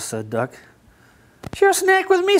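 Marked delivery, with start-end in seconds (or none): 1.5-2.4 high, friendly goat offer; a goat sharing its snack. A narrator's reading. Goat offering Duck a snack, high-pitched. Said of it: "said Goat".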